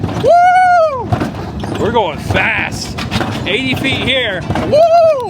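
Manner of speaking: high, loud whoops of speed; thrill-ride shouting. Real, not acted. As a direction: yell with joy down the track. Fast coaster ride.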